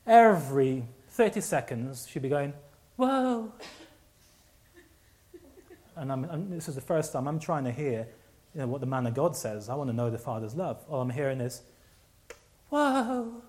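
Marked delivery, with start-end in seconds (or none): 3.0-3.5 high, drawn-out mock whoa; stupid voice. Lady near the front interrupting the preaching, stupid high voice. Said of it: "putting that stupid voice on". 12.7-13.4 high, drawn-out mock whoa; stupid voice. Lady near the front interrupting the preaching, stupid high voice. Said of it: "putting that stupid voice on".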